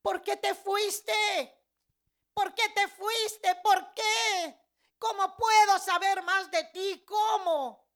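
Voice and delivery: loud, high, drawn-out desperate yell; shouting. Retold or imitated. Woman calling after Jesus who left, desperate shouting. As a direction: shout the questions up in anguish. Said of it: "le comencé a gritar y le dije".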